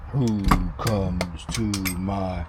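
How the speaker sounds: deep slow vampire line, wrong words; slow gloomy vampire growl, first try. Retold or imitated. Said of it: "no, that's not how he said it".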